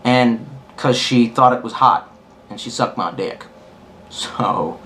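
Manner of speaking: ordinary delivery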